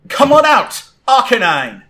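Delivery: big high summoning shout. Acted. Trainer sends out her next Pokemon with a shout. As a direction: shout your creature out onto the field. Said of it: "pulls out her next Pokeball and shouts".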